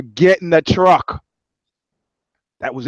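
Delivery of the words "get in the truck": slow, louder mock command; insistent retold order. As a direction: repeat the order slowly, brooking no argument. Soldier insists after boy refuses.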